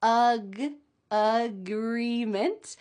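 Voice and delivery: ag- said alone, then agreement slowly; teacher building up agreement slowly; broken into parts. Shown as a demonstration. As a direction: split off ag- before the full agreement. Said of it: "First, we have agreement".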